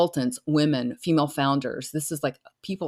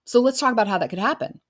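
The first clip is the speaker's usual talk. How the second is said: eager agreement; 7 semitones higher than usual. Retold or imitated. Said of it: "you're coming in there to say".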